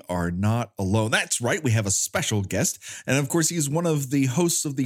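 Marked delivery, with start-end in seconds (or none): none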